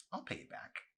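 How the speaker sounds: a soft whispered plea; whispered promise. Acted. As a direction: whisper it, almost sheepish. Mandy pleads with Arson.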